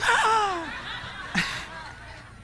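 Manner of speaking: mock gasp. Mimics audience's shocked gasp.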